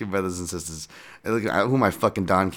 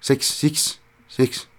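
"Six" said with a Kiwi vowel, the i pulled toward "sux", higher; New Zealand "six"; 8 semitones higher, much slower than usual. Shown as a demonstration. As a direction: show off the Kiwi way of saying "six". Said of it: "We say six instead of six".

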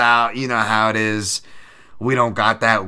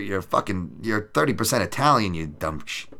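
ordinary talk, nothing marked

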